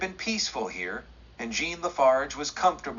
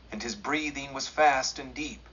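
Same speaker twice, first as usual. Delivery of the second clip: ordinary delivery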